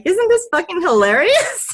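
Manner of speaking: high, loud, cracking up; delighted. Retold or imitated. Texting her best friend a funny dog photo, gleeful. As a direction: squeal it with glee, laughing. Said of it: "I'm like".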